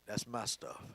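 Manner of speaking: low, hushed, possessive father; daddy's way. Shown as a demonstration. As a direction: say it like a dad guarding his things, quietly. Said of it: "my daddy would have said it simply like this".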